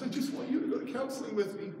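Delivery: high, soft imitation of a sobbing wife; mock-tearful little plea; tearful pleading. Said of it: "she's sobbing in bed".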